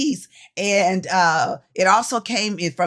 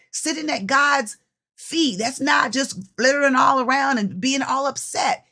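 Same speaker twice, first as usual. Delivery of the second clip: ordinary talk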